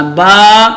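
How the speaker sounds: با with a long, ringing "aa"; alif-style pronunciation. Shown as a demonstration. Teacher leading students' repetition, long held "baa". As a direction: hold با firmly as "baaa". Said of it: "الف کے ساتھ تلفظ کرنا ہے".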